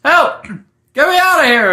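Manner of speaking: loud, high-pitched yell; acted cry for rescue, high and loud; screaming for help. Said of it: "screaming out of the hole".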